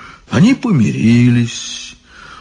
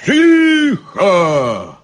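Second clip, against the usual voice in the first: high, drawn-out bark for silence; barked command; 9 semitones higher than usual. A narrator's reading. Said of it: "рявкнули все три головы Горыныча". (рявкающий окрик)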